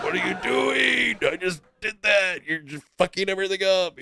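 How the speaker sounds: high-pitched mock outburst; exasperated protest. Telling his painting partner to stop ruining it, exasperated. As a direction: re-enact a frantic, high complaint.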